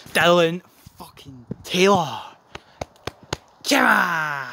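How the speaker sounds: loud, slowed disbelieving yell; exasperated shout. Real, not acted. Fan yelling at his own penalty taker in disbelief. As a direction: bellow his name in frustration.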